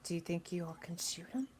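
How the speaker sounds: hushed whisper to the team. Real, not acted. Player whispering a plan to her team. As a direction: whisper it so no one hears. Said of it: "I love that you're still whispering".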